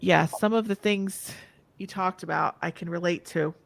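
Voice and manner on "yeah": usual speaking voice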